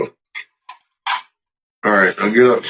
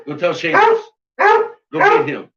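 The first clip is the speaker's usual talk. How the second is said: squeaky dog voice. Retold or imitated. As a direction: urge on like a squeaky cartoon pet. Voicing dog in high voice.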